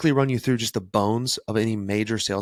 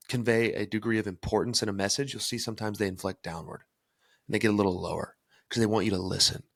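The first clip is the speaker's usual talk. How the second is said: ordinary talk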